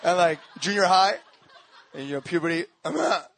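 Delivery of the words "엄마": 1 rushed mumble. Retold or imitated. Teen son rattling it off.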